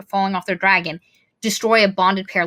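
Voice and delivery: ordinary delivery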